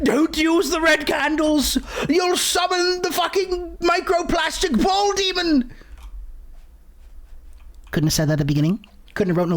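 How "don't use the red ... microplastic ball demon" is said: high, slow mock shout of a warning; psychic shouting a warning. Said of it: "he's like".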